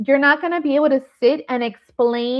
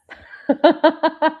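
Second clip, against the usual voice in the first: real burst of high laughter; 8 semitones higher, 4 dB louder than usual. Real, not acted. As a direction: laugh out loud in a quick run. Laughing at a viewer's comment.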